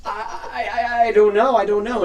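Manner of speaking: high, loud, stuttering waitress; acted frightened protest; panicked stammer. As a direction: stumble over it in a fluster. Waitress panics under questioning.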